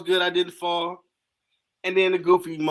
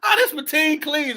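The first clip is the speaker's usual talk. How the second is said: high-pitched mocking exclamation; teasing friend; 11 semitones higher, 3 dB louder than usual. Homeboy teasing his injured friend with a nickname, teasing imitation. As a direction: crow it at your injured buddy. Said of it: "he looked at me like".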